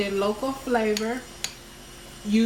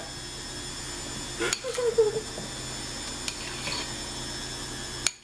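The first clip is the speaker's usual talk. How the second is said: long laugh; 13 semitones higher, 6 dB quieter than usual. Real, not acted. Laughing at husband not caring.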